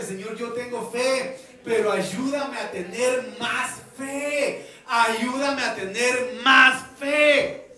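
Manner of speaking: preacher leading the church in prayer, slow and swelling. Shown as a demonstration. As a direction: pray it with the congregation, building. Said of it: "Levante sus manos y dígale".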